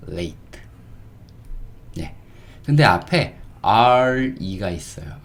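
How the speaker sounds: usual speaking voice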